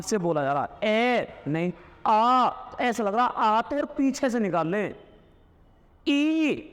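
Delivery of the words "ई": long high ई held out; vowel ई demo. Shown as a demonstration. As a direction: sing out a clear ई for the class. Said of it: "जीभ के अगले हिस्से में जोर पड़ रहा है".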